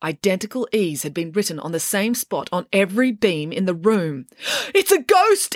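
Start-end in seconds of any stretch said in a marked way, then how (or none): 4.7-5.6 high-pitched alarmed cry; startled, high outburst; alarmed exclamation. A narrator's reading. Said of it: "exclaimed Sienna".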